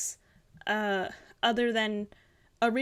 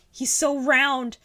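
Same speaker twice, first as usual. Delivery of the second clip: admiring delight; 3 semitones higher, 7 dB louder than usual. Real, not acted. Admiring the round character.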